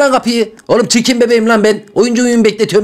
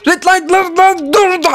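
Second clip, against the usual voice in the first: urgent call; 7 semitones higher, slower than usual. Acted. Yelling stop at moving player.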